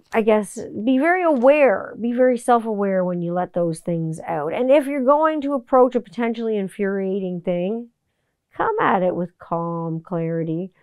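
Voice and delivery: usual speaking voice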